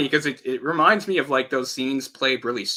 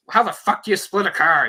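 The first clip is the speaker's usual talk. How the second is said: louder, higher imitation of an exasperated man; mock angry; 7 semitones higher, 6 dB louder than usual. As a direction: act out his outrage at the deal. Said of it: "he's so mad after everything he's been through".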